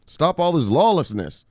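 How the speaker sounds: loud, slow mock decree; playfully stern lawman; mock stern order. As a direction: lay down the law, hammed-up.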